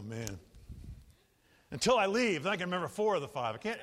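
ordinary delivery

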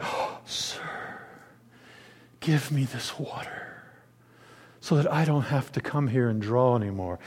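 breathy gasp, then hushed, low plea; hushed and low, imitating an awestruck woman; mock awe. Woman at the well responding to Jesus, in mock awe. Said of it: "she's responding like".

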